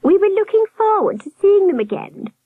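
very high warbling falsetto; high-pitched nurse. A narrator's reading. Nurse regretting the absent couple, high warble. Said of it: "the nurse murmured".

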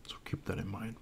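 hushed aside about brother; whispering. Real, not acted. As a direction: drop to a whisper.

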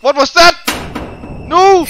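loud, high startled cry; high-pitched alarmed yell. Real, not acted. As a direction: blurt it out in shock. Startled during horror game.

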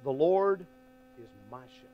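"The Lord is my shepherd" slow, leaning on "my"; stress on my. Retold or imitated. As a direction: draw out "The Lord is my shepherd", pressing "my". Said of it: "Boy, he put emphasis on that".

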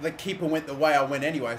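usual speaking voice

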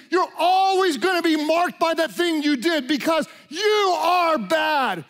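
relentless, hammering condemnation, building at the end; devil's accusation. Acted. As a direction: pound out each accusation like a verdict. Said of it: "the devil wants you to believe".

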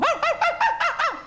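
high yelping little dog; squeaky pained dog yelps; yapping Yorkie. Retold or imitated. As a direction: yelp like a tiny dog being dragged. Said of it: "the Yorkie's going".

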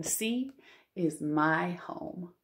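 usual speaking voice

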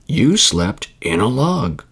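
slow, with "in" picked out; slowly correct, leaning on "in"; gentle correction. A narrator's reading. Mother bear corrects child's saying, slow gentle correction. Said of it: "corrected Mother Bear gently".

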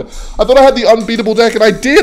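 loud high exasperated rant; frustrated rant. Real, not acted. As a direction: rant about losing, loud. Ranting after losing.

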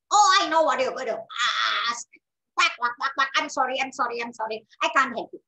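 quick, quacking duck voice; loud burst, then hurried quacky apologies; refusing duck. Acted. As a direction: brush it off like a busy duck.

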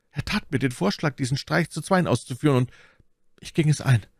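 high-pitched confessing; anxious captive voice; frightened bandit. Acted. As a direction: own up nervously to your captor.

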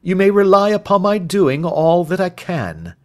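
lightly voiced, dependable sleuth; reassuring Holmes. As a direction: pledge your help with assurance.

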